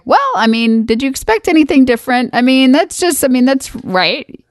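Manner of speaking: imitating husband. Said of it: "And Penn's like".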